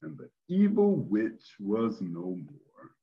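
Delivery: storyteller reading a fairy tale ending; narrating the end of a fairy tale, lively and expressive; story narration. A narrator's reading. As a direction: read it out like a bedtime story. Story narration read aloud.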